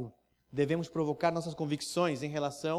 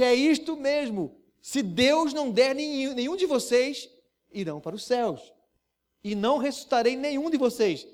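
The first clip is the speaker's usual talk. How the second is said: higher, louder, unyielding; insistent proclamation; 8 semitones higher, 6 dB louder than usual. Acted. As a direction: repeat it with full conviction. Jesus repeats his hard claim.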